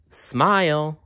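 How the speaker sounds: high, bright little call to smile; lightly voiced cheerful bear; cheery bear. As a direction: coax a smile out of them.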